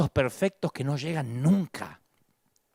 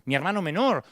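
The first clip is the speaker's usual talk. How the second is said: ordinary talk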